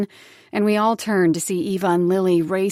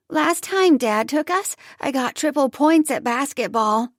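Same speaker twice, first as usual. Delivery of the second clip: put-on higher child's voice; bragging little girl; 6 semitones higher, 3 dB louder than usual. Daughter bragging to mother, high child voice. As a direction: boast like a small kid. Eva continues.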